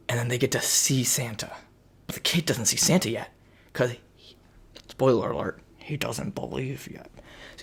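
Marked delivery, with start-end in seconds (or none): none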